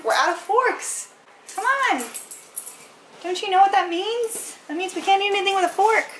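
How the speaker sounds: high-pitched playful dog talk; mock outrage at the dogs. Real, not acted.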